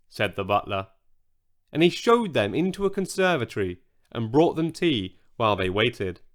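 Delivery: ordinary delivery, nothing marked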